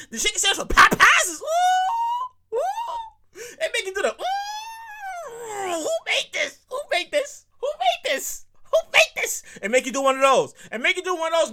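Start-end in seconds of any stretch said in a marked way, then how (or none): 0.1-9.4 high falsetto oohs, rising questions; ecstatic moaning. Real, not acted. Host describing the sandwich, high ecstatic oohs. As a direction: overcome by how good it is. Raving about the sandwich taste.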